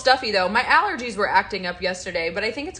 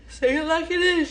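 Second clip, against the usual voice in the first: quoting a viewer; 9 semitones higher, 3 dB louder than usual. Retold or imitated. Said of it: "she says".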